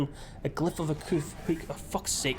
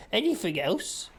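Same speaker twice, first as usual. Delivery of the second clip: higher, rising cult member question; cultist voice; 5 semitones higher, slower than usual. Acted. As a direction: ask it like a creepy cultist. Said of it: "cultist voice".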